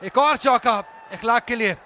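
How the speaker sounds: loud live play-by-play; excited cricket commentary. Real, not acted. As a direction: call each boundary with a shout. Shots called live. (उत्साहित cricket commentary)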